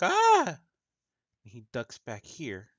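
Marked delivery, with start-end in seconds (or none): 0.0-0.6 very high, loud yelp of alarm; startled scream. Acted. Guard ducks back at seeing them, high startled scream. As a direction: scream in sudden fright. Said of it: "And he's like".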